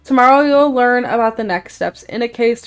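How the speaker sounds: announcer voice. Acted. Reading game prompt in voice.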